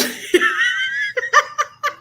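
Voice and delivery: a long high laugh; helpless laugh. Real, not acted. Streamer losing it over Lola's comment, helpless laugh. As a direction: lose it completely. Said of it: "I done lost".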